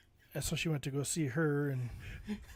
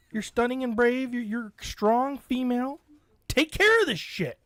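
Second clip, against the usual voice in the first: higher, loud mock-encouraging pep talk; mock cheerleading; 7 semitones higher, 4 dB louder than usual. Telling his stranded wife she can handle it herself, mock-cheering. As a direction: hype her up sarcastically.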